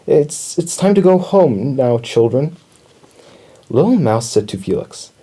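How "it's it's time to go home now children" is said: higher, faltering teacher's voice; shaky voice. A narrator's reading. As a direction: stammer it out, mildly shaken. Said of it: "said in a shaky voice".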